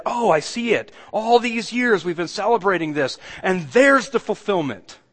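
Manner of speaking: high, loud voice of dawning recognition; acted sudden realization. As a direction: act out the moment it all clicks. Acts out the listeners' imagined realization.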